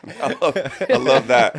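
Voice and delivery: laughing out loud. Real, not acted. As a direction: crack up while saying it. Listener cracking up.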